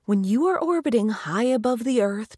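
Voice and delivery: plain reading voice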